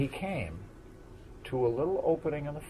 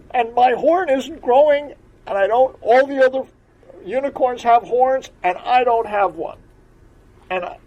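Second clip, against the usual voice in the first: put-on high, loud childlike pleading; young unicorn explaining to the frog why he's sad; little unicorn voice; 12 semitones higher, 14 dB louder than usual. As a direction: pour it out like an upset small child.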